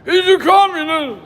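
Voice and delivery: high, loud mocking accusation; mock shout. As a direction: bark it like a hostile heckler. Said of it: "Or you've got".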